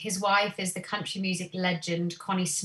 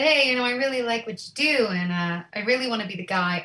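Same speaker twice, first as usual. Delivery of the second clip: imitated American accent, quicker and a bit raised; 3 semitones higher, 4 dB louder, faster than usual. Musician praising the young band after the gig, imitated American accent. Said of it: "I'll stop doing the accent now".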